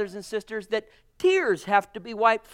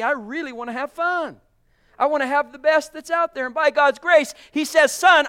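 louder and higher, preaching with fire; full-force preaching; 6 semitones higher, 9 dB louder than usual. Acted. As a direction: lift the congregation up, loud and high.

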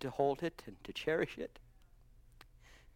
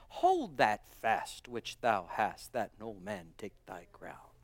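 solemn scripture; 7 semitones lower, 4 dB quieter, slower than usual. Acted. Solemn scripture, low and slow.

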